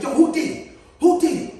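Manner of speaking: loud, high demand from a big brother; demanding who did it. Retold or imitated. Brother and cousin demanding answers, loud and high. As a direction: call out the culprit, loud and high.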